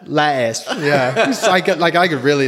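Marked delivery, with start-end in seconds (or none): 0.0-1.3 "Lettuce" in his Welsh accent, said higher. Shown as a demonstration. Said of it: "One more time, water and".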